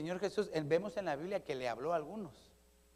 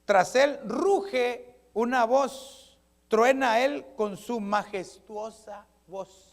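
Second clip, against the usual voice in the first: loud, drawn-out, higher proclamation; thunderous scripture reading; 5 semitones higher, 8 dB louder, much slower than usual. Acted. Preacher reading Job on God's roaring voice, majestic. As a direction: proclaim it slowly and forcefully.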